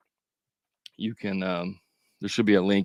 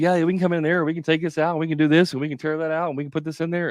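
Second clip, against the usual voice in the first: rushed fast talk; 7 semitones higher, faster than usual. Shown as a demonstration. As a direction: rattle it off quickly and higher. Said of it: "you're talking really fast like this".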